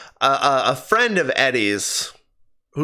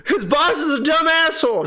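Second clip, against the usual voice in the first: laughing rant; 10 semitones higher than usual. Real, not acted.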